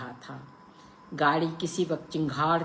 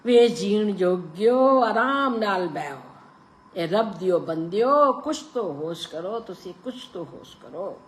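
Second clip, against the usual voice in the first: put-on pleading old woman; 5 semitones higher, 7 dB louder than usual. A narrator's reading. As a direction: beg them to calm down.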